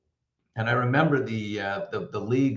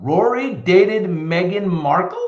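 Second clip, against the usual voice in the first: disbelief; 7 semitones higher, 6 dB louder, slower than usual. Real, not acted. Reading surprising news.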